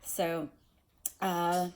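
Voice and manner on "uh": ordinary delivery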